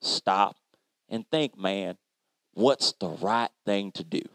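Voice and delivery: God calmly asking Cleo to stop and think. Retold or imitated. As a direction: speak as God gently correcting him. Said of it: "he said".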